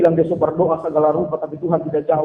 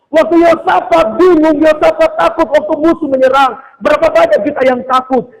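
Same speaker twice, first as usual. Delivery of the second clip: high, loud sermon delivery; forceful preaching; 7 semitones higher, 5 dB louder than usual. Real, not acted. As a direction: drive each fear home to the congregation. (khotbah berapi-api dengan suara tinggi)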